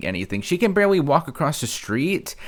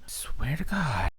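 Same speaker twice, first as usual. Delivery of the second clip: ordinary talk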